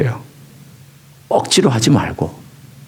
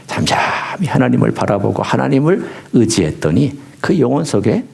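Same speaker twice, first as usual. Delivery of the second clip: ordinary delivery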